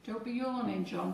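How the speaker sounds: usual speaking voice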